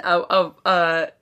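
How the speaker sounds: ordinary delivery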